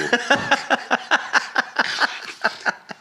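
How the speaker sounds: breathless high-pitched laughter; high wheezing laugh. Real, not acted. Teller cracks up at the actor's reply, a high wheezing laugh. Laughing after ironic quote.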